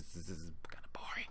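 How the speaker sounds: whispered, bored aside. Retold or imitated. Reviewer mocking dull dialogue, whispered. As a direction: breathe it out as if half asleep.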